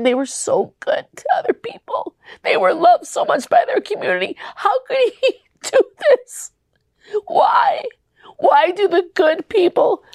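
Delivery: crying through the questions, half whispered, half wailed; real crying. Real, not acted. Grieving daughter asks why God took her mom, crying. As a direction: voice breaking into tears, pitch leaping up. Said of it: "I'm crying right now".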